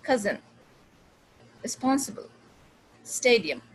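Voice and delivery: कजिन, रिस्पॉन्सिबल, स्टेडियम with reduced weak vowels; careful word list कजिन, रिस्पॉन्सिबल, स्टेडियम with short neutral uh; कजिन, रिस्पॉन्सिबल, स्टेडियम, strong stresses and relaxed weak syllables; quick schwa. Shown as a demonstration. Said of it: "quick अ जो sound".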